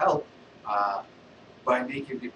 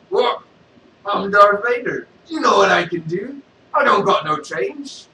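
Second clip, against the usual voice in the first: loud indignant Vader, higher; mock-offended Vader, louder; Darth Vader; 6 semitones higher, 11 dB louder than usual. Vader answers the beggar.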